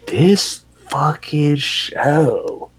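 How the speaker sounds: high, very slow, stretched-out words; overwhelmed groaning drawl. Retold or imitated. Viewer reacts to the death scene, drawn-out exasperation. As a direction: drag each word out in disbelief.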